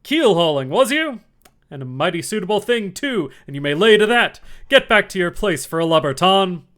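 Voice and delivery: loud, high scornful scold; gruff dismissal. A narrator's reading. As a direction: send him off with contempt. Silver dismisses Morgan.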